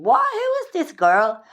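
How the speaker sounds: high, slow bewildered viewer; mock viewer disbelief. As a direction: play the baffled viewer, drawn out and high. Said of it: "you're probably thinking".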